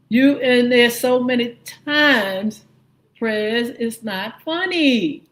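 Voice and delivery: slow, drawn-out mock voice; mocking quote. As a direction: ham up her words, slow and sassy. Quoting Bondi to Trump.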